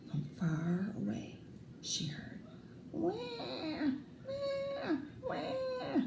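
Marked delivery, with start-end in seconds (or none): 2.9-6.0 put-on very high, soft baby wah cries; far-off baby wailing. Baby brother crying from the gorilla cage, high soft wails. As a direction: wail like a distant baby.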